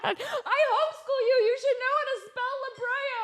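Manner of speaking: high, laughing mom voice, scolding; hammed-up exasperated mom with a laugh; mock scolding mom. Mom scolding her daughter over spelling, high laughing mock scolding.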